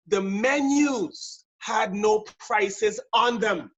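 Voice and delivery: very slow, each word weighed; slow and deliberate. Shown as a demonstration. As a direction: drag it out so it lands. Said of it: "I'm gonna let that sink in".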